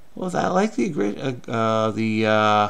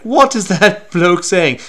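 louder, slower, higher put-on British voice; mock British accent; 5 semitones higher, 7 dB louder, slower than usual. Imagined English listener reacting to the false accent, mock British. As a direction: play an incredulous Brit, hammed-up accent. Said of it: "if you really are in England".

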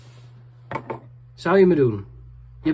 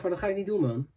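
ordinary talk, nothing marked

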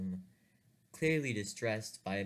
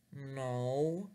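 sheepish; 4 semitones higher than usual. Retold or imitated. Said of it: "responded with a sheepish".

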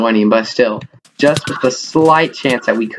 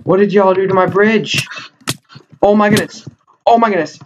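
shocked outcry; 7 semitones higher than usual. Real, not acted. Bridge destroyed by opponents.

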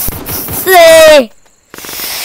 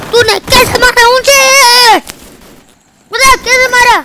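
higher, louder outraged question; indignant protest; 7 semitones higher, 4 dB louder than usual. Real, not acted. Player protesting to his killer, loud outrage. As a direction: demand to know how.

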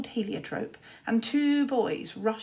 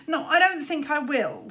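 smiling refusal; 6 semitones higher, 4 dB louder than usual. Acted. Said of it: "smiled Luther".

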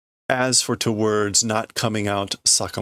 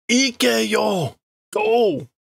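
shouted command; 11 semitones higher, much slower than usual. Acted. Sakamoto yelling at her to go. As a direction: shout it high.

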